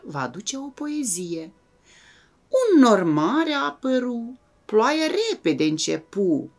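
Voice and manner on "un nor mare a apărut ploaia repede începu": put-on reciting a children's poem, opening line higher and louder; poem recitation. Teacher recites a rain poem to the class, in a lilting, rhythmic recitation.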